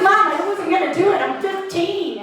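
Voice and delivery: put-on high-pitched teenage indignation; mock protest. Fifteen-year-old answering mom's cocaine offer, mock indignant.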